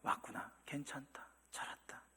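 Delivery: whispered, very soft welcome; father whispering to the returning son; tender whisper. Pretend. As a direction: hush it like an embrace. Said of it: "그렇게 말하지 않았다니까요".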